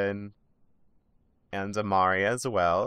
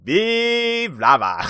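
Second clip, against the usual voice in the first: Omari; 9 semitones higher, 4 dB louder, much slower than usual. Retold or imitated. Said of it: "Omari goes".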